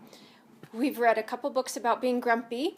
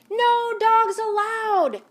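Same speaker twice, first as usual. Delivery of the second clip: much higher, louder, slow angry shout; grumpy yell; 11 semitones higher, 9 dB louder, slower than usual. Acted. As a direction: shout the dog away. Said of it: "Hank yelled".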